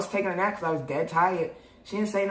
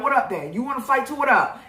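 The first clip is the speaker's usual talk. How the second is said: challenging the cop; 7 semitones higher than usual. Retold or imitated. Squaring up to the cop, she taunts him to fight. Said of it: "I'm like".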